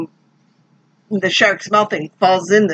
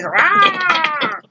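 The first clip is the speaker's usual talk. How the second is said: shark roar; 8 semitones higher than usual. Retold or imitated. Imitating the shark roaring at the boat, mock roar. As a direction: belt out a cartoon roar. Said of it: "it roars".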